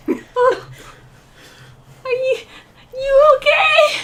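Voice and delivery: high, anxious character voice; worried checking. Acted. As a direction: ask slowly, rising into panic. Character frets over the fallen.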